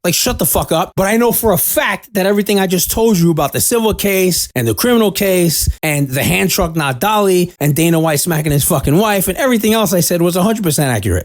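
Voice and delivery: ordinary delivery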